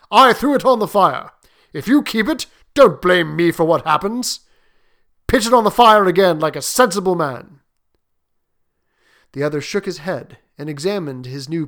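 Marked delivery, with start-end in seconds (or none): 0.1-7.6 loud, forceful warning; dogged soldier. Acted. Soldier urging his friend to burn the paw, forcefully. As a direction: insist loudly, as a stubborn old soldier.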